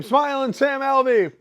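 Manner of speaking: high, drawn-out announcer hype; ring announcer call. Retold or imitated. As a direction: stretch the name like an arena announcer. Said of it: "They call my name".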